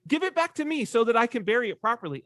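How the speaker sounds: high, fast pleading; Mary pleading with the gardener; Mary's voice. Retold or imitated. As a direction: beg the gardener for the body. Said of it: "And she says".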